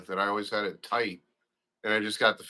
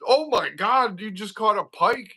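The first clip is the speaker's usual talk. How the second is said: higher, excited cheer at the catch; retold excitement; 9 semitones higher than usual. Retelling cheering his son's pike, excited and higher. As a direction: cheer him on like it just happened. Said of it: "I'm like".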